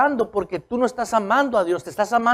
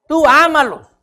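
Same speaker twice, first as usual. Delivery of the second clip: exhortación fuerte; 5 semitones higher, 8 dB louder than usual. Real, not acted. Exhorting the flock to love.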